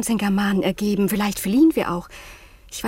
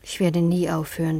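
ordinary delivery

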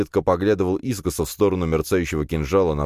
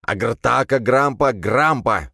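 put-on raised, lightly crazed old priest voice; mad priest's incantation; 7 semitones higher, 4 dB louder, slower than usual. A narrator's reading. Mad old priest waves his staff at newcomers, chanting. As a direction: cry out nonsense spell words, higher.

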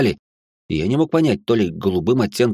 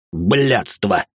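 father's mildly raised oath; barked curse. Acted. Father cursing before his family, barking. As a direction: bark out the swear word. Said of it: "гаркнул отец".

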